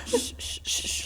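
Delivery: whispered shushing. Retold or imitated. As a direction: hush someone softly. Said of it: "It's like".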